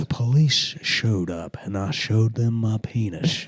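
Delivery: slow Texas stand-up drawl; Texas accent. Acted. Comic improvising another stand-up's bit, slow Texas accent. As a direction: tell it slowly in a Texas accent. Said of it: "maybe I'll just do a Texas accent".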